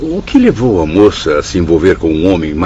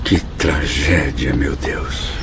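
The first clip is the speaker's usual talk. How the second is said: dismayed sigh; 5 semitones lower, 5 dB quieter, slower than usual. Acted. Hears of the daughter's death.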